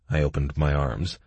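usual speaking voice

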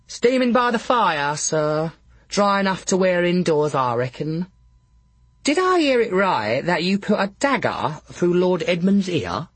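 high-pitched laundress voice. Acted. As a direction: pitch her high, a chatty working woman questioning the fool.

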